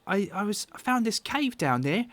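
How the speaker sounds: put-on high, halting child voice; little lad insisting on his story; Carp, young boy. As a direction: tell it as an eager small boy. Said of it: "And Carp pipes up".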